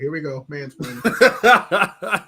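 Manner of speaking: slow sarcastic drawl; mock-weary eye roll in the voice; slow sarcastic mumble, laughing; sarcastic aside. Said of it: "I was like".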